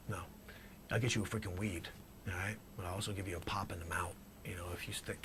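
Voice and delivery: usual speaking voice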